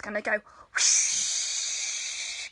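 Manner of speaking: long airy whoosh sound; breathy whoosh. Shown as a demonstration. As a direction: blow it out like wind. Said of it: "we're gonna do some whooshes".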